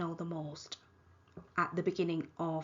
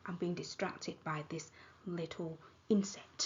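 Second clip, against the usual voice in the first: whispered aside; 6 dB quieter than usual. Real, not acted. Aside about an insect.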